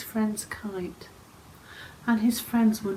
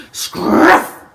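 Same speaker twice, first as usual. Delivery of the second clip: fiery dragon blast; 7 semitones higher, 10 dB louder than usual. Acted. Dragon breathing fire.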